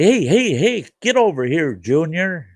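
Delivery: put-on high, loud call of an old drunk; calling the boy over. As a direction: beckon the kid over loudly. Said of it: "And Old Irv would say".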